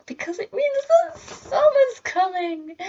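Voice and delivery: high-pitched, giddy excitement; excited squeal. Real, not acted. Student telling viewers summer is on the way, excited squeal. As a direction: let the excitement shoot the voice way up.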